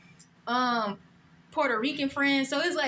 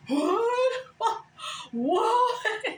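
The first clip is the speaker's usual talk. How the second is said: mock shocked coworkers; 9 semitones higher than usual. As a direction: squeal their stunned what.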